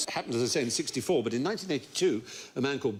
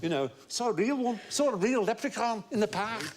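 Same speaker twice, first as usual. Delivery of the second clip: high, mock-excited children's voices; pitched-up, eager retelling as children; excited kids; 7 semitones higher than usual. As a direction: imitate Liverpool kids telling a wild story. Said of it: "so they all said".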